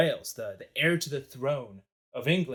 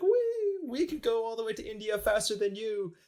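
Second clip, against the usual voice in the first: taunting French; 7 semitones higher than usual. Acted. The French jeer at the British from the canal, high taunting voice. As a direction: sing out a gloating taunt, high and quick to mock. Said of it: "looking at the French".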